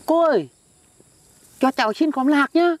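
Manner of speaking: sweet asking voice. Shown as a demonstration. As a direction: put on a sweet little nephew's voice to beg a peanut plant. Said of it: "Tao xin nha".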